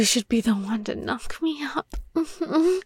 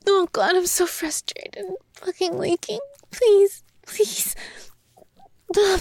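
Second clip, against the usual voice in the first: desperate pleading; 4 semitones higher, much slower than usual. Acted. Pleading with her partner for permission, desperately. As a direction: beg harder and harder.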